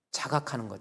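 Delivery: normal talking voice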